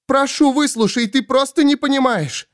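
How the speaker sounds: very high, urgent plea; high, frantic woman's entreaty; imploring Svol. Acted.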